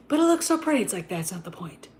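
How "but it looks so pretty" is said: mocking high voice, much higher; mocking Dee Dee. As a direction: mimic her protest, pitched way up. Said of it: "Dee Dee was like".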